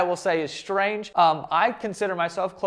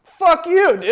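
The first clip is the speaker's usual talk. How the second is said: much higher, louder playful outburst; mock outrage; 11 semitones higher, 9 dB louder, slower than usual. Real, not acted. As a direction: yell it in fake offense. Defending grown men's baths.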